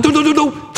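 high, loud scampering sound; mock running noise. Dog bolting out the door, high playful noise.

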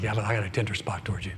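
soft, quick, tender reassurance; gentle voice of God. Pretend. God tenderly answering a doubting believer, soft. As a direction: lean in and reassure the sinner softly.

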